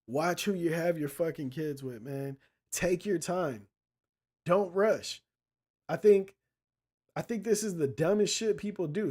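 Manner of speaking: ordinary delivery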